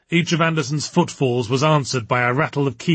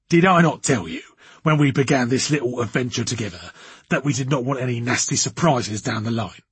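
put-on blustering man, forceful opening; irate, overbearing boss. A narrator's reading. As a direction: dress down a subordinate in character. Voices Anderson, angry boss.

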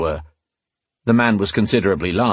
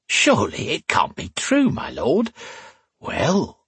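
high-pitched mock disbelief; flirty tavern woman; 9 semitones higher, slower than usual. A narrator's reading. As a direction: coquettish high swoops.

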